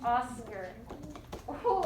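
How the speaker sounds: normal talking voice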